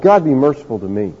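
soft low plea for mercy; humble plea. Retold or imitated. Tax collector crying out to God, soft humble plea. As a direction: beg quietly, broken.

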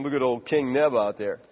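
lightly voiced servants mocking. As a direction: snicker about the king quietly. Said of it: "his servants are in there thinking".

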